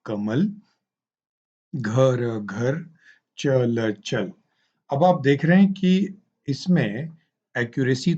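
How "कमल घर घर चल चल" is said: halting, each word said twice; word-by-word child reading. Shown as a demonstration. Teacher showing how a beginner reader stumbles, halting. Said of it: "वो पहले पढ़ते हैं कमल".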